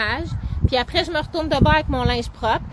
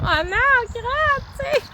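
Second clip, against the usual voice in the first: high, startled oh no; dismayed cry; 12 semitones higher, much slower than usual. Retold or imitated. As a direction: squeal it out in mock panic. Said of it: "on est comme".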